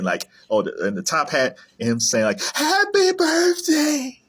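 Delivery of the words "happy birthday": very high, drawn-out cartoon snowman cry; Frosty the Snowman. Fan quoting the cartoon snowman's catchphrase, high and drawn out. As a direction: stretch it out in a high, gleeful put-on voice.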